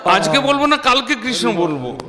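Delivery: lightly acted high-pitched protest; acted refusal. Acted refusal quoted from the story.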